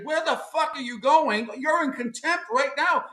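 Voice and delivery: high-pitched mock courtroom outburst; outraged judge. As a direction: play an incredulous judge calling someone back. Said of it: "What did the judges say?".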